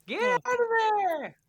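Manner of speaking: very high, slow mock scream; high mock yell. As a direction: squeal it out. Joining the movie quote.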